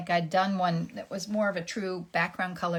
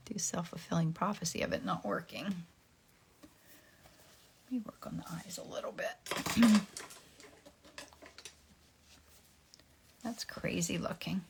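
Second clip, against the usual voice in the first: whispering; 5 dB quieter than usual. Real, not acted. Whispering to herself while painting.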